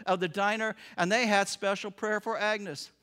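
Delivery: ordinary delivery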